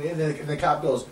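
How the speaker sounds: usual speaking voice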